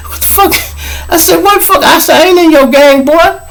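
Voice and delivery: high, disbelieving retelling; telling the story in laughing disbelief; incredulous. Retold or imitated. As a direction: pitch shot up, still amazed.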